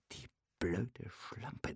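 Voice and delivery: zacht en traag, bijna gefluisterd; gefluisterde gedachte. A narrator's reading. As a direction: sis het binnensmonds. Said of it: "dachte er".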